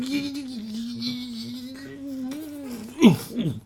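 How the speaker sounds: long high wordless whine of rage; drawn-out apoplectic squeal; strangled furious squeal. Acted. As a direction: squeal as if about to burst. Angry short man losing it.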